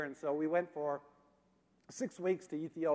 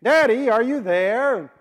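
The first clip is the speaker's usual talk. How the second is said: put-on higher, louder and slow small boy's call; little son calling for daddy in the dark train car; 7 semitones higher, 13 dB louder, slower than usual. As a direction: draw it out like a sleepy child calling for daddy. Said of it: "the voice came out of the darkness again".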